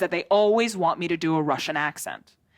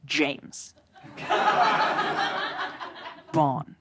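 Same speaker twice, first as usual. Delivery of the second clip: a lower, slow, sultry-read audition line; 5 semitones lower, slower than usual. Shown as a demonstration. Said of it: "the only line that I have in the entire script is".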